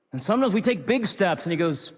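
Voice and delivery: ordinary talk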